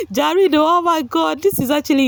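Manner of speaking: laughing. Real, not acted. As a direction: laugh out the name. Laughs then exclaims.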